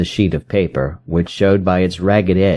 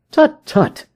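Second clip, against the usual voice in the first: a sharp, much higher "tut, tut"; brisk cry. A narrator's reading. Holmes cuts off his client's despair, sharp high cry. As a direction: snap him out of his gloom. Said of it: "cried Sherlock Holmes".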